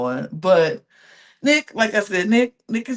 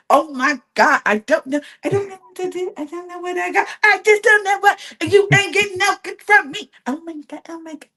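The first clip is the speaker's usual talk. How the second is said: mock flustered Bella; 9 semitones higher, faster than usual. Mocking confused Bella.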